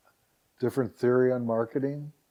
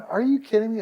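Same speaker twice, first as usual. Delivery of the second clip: higher, louder astonished outburst; surprised disbelief; 9 semitones higher, 4 dB louder than usual. Retold or imitated. Retelling his shock at the TV mention, higher and louder. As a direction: react like you can't believe it. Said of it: "And I'm going".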